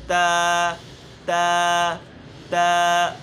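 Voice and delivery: تا loud and crisp; correct ta. Shown as a demonstration. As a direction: model تا for listening. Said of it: "آواز کو note کریں". (تا درست ادائیگی)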